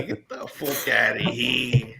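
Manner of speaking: hammed-up New Jersey accent. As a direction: stretch it out in mock Jersey. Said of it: "a Jersey accent does it for you".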